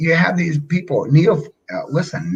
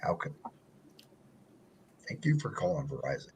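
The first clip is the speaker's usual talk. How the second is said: Verizon voice; 7 dB quieter, faster than usual. Retold or imitated. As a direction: slip back into the smooth company greeting. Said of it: "my Verizon voice".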